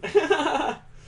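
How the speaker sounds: high, loud burst of laughter; surprised real laugh. Real, not acted.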